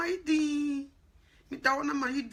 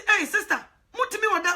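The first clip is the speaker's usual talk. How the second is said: raised call across the street; 7 semitones higher, 6 dB louder than usual. Retold or imitated. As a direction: hail the sister loudly, half laughing. Calling out to sister in street.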